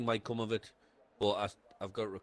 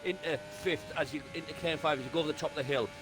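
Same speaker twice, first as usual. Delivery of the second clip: brisk running race commentary; live play-by-play; 4 semitones higher than usual. Acted. Co-driver talking the viewers through the lap, commentary voice. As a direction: call every corner of the lap as it happens.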